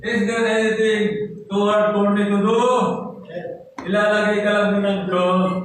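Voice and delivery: loud, high, ringing pulpit voice; preaching at full force. Acted. Preacher urging the congregation not to fear, full force. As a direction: proclaim it over the whole room.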